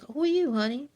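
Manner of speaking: a doting relative caught off guard; sweet surprised elder. Retold or imitated. Relative greeting partner, high sweet surprise. Family shocked to meet partner.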